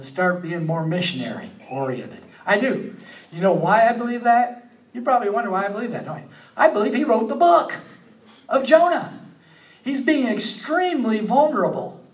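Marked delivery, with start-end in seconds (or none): 2.5-12.0 much higher and louder, fervent preaching; preaching at full force. Real, not acted. Preacher telling the congregation Jonah wrote the book, fervent. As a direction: build the sermon to its peak.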